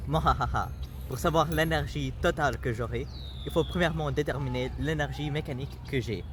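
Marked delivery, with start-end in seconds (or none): 0.1-0.7 gloating mwahaha; cartoon villain cackle; evil villain laugh.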